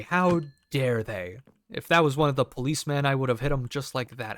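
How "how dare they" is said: drawn-out fake indignation, a touch off his own voice; how dare they, slowed and put on; slow mock outrage. To the meth head, a slow, put-on how dare they. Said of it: "So I say".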